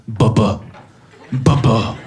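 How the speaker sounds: low, loud heartbeat "bubba"; toddler doctor. Retold or imitated. Comic mimicking his daughter playing doctor with a stethoscope, in a thumping heartbeat voice. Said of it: "puts the other bit to your heart and goes".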